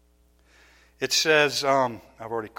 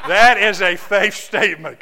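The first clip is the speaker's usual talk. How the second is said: laughing wry aside; 7 semitones higher, 13 dB louder than usual. Retold or imitated. Repeats wry thought laughing.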